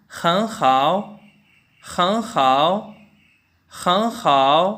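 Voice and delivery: खं खाओ as hén hǎo, rising hen then a dipping hao; Mandarin hěn hǎo. Shown as a demonstration. Teacher models the third tone rule for learners. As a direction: lift खं, then dip खाओ. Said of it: "मसलन".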